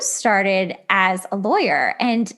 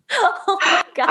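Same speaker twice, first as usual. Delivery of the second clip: real laughter spilling into Oh my God, pitched up; laughing; 9 semitones higher than usual. Real, not acted. Host still laughing at the French-speaking joke, giggling. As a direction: let it ride on the laugh.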